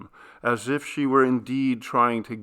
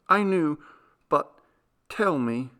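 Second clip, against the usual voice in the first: wife urging her husband to tell her; Clarice, gently urging; 5 semitones higher than usual. A narrator's reading. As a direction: coax him to speak. Clarice voiced higher.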